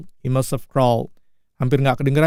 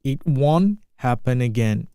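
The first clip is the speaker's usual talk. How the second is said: slow, clear model line; slow repeat; slower than usual. Shown as a demonstration. Teacher to students, slow careful model line. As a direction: give each word room. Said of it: "teacher repeats film line slowly".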